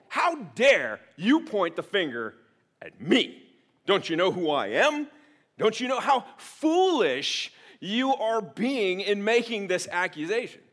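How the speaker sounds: loud, affronted, slowing on "foolish"; mock indignation. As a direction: play the wounded ego. Acting offended under accusation.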